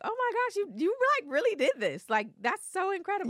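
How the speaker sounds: high-pitched amazed gushing; retold excitement. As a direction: squeal it in acted disbelief. Retells their excited reaction to news.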